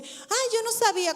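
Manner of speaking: a retold sarcastic "ay, yo no sabía". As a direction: play the sister's mock surprise, dripping sarcasm. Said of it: "con sarcasmo".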